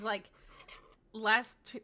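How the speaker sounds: ordinary delivery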